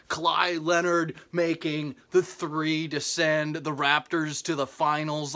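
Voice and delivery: usual speaking voice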